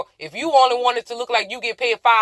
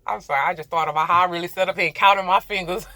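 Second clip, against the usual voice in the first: laughing through the apology; real laughter at herself; 3 semitones lower than usual. Real, not acted.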